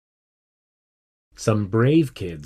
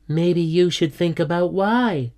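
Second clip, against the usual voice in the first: higher-pitched, pointed schoolgirl retort; pointed reply; 7 semitones higher than usual. A narrator's reading. Tess answering her friend's complaint, pointed and high. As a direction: suggest it pointedly, pitch lifted.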